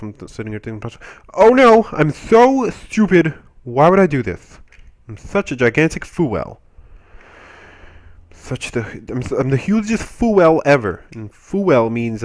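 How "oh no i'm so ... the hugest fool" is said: hammed-up, higher and louder dismay; mock self-berating. Berating himself for touching the hot thing, mock dismay. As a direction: scold yourself in exaggerated regret, easing off.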